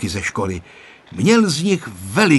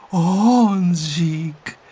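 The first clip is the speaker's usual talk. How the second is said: gently amazed, pitched up; breathed-out discovery; 7 semitones higher than usual. A narrator's reading. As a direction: let the name out on finding him. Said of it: "vydechla Tereska".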